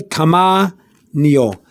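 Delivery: "tamaño" slowly split, tama-nyo; slow teaching "tamaño", nyo set apart; syllable-by-syllable pronunciation. Shown as a demonstration. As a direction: pause before the last syllable of "tamaño". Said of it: "look at the word".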